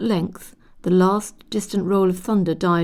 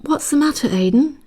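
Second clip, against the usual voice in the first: higher, worried question; anxious; 8 semitones higher, 3 dB louder than usual. A narrator's reading. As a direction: ask it anxiously into the darkness. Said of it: "inquired Kirsty anxiously".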